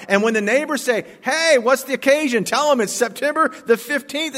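rally the congregation to celebrate; joyful pulpit shouting about a very good day; full-force preaching. Acted.

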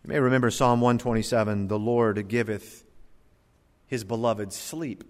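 ordinary talk, nothing marked

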